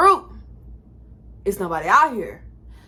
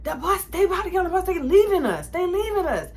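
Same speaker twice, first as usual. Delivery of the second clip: urgent panic; 8 semitones higher than usual. Retold or imitated. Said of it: "I'm like".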